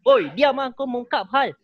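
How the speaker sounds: heated battle-rap shout; angry shout. Shown as a demonstration. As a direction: snap at the noisy crowd. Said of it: "Kan beza kan".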